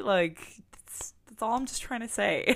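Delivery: normal talking voice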